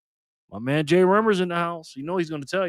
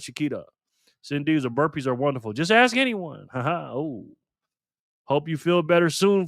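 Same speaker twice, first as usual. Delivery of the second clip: normal talking voice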